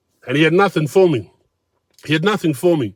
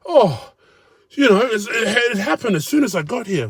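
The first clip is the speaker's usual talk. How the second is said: higher, self-pitying whine; higher mocking whine of a sulky diner; whiny mock complainer; 9 semitones higher than usual. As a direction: sound like a sulky grown man. Imitating the complaining man.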